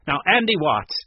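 loud, firm demand. A narrator's reading. Woman confronting the man, demanding. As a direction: press him for an explanation. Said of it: "Doris demanded".

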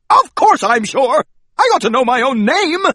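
furious mouse insisting on his name; exploding. Acted. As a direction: explode at the clerk in outrage. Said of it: "I exploded".

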